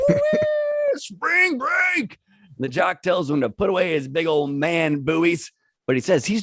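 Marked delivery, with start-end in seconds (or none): 0.1-2.2 high, whooping party cry. A narrator's reading. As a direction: holler it like a wild spring breaker. Voicing hillbilly ripping off shirt.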